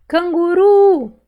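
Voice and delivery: "Canguru" slow and loud, high long RU; stretched stressed syllable. Shown as a demonstration. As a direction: call out "Canguru", holding RU. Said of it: "Qual que eu demorei mais falar".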